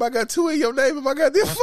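real laughter through the words; laughing confession. Real, not acted. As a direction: admit it while cracking up. Cracks up admitting the misspelling.

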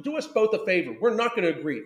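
normal talking voice

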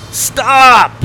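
high, loud, stretched yell; insistent shout. A narrator's reading. Boy repeating his order to grandpa, insistent shout. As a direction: yell the halt with full force. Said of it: "Sawyer repeated".

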